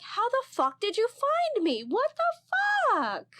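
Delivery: high, slow, shrieking mock alarm; shrieked mock panic. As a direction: squeal it out like you've been hunted down. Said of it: "And I was like".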